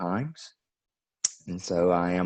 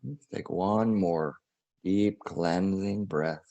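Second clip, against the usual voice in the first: guided meditation; 2 semitones higher than usual. Acted. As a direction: ease the listeners into breathing and release.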